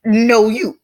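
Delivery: high, loud playground comeback; mock childish retort. Telling viewers how not to reply, mock childish retort. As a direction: fire back a high, loud "no, you". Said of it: "and be like".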